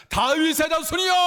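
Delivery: high, loud desperate call; crying out loudly. Acted. Blind man calling Jesus despite the crowd, crying out loudly. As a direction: shout it out over those holding you back. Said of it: "더 큰 소리로 외칩니다".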